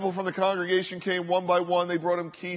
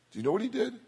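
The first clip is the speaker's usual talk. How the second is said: ordinary talk, nothing marked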